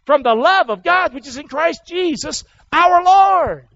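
loud, high, rising scripture proclamation; preaching at full force. Acted. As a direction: preach it to the rafters.

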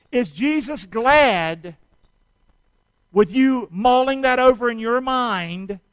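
loud, pressing sermon questions; preacher hammering at the crowd; full-force preaching. Acted. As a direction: drive every question home to the congregation.

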